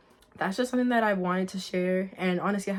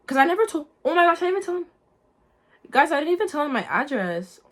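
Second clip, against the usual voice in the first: sudden high, loud realization; gasping it out as it dawns on her; real disbelief spilling out; shocked realization; 8 semitones higher, 5 dB louder than usual. Real, not acted. Sudden realization mid-story.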